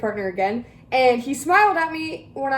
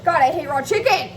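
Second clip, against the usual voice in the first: ordinary delivery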